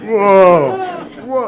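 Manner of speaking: put-on a stoned kid blown away; hippie whoa. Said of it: "back then my language was like".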